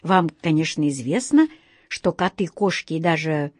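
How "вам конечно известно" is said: ordinary delivery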